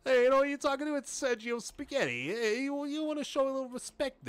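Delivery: high, loud Italian mobster bravado; Italian gangster. Pretend. Gangster character Sergio Spaghetti introduces himself, Italian mobster voice. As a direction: puff up like an Italian wiseguy demanding respect. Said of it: "I want an Italian".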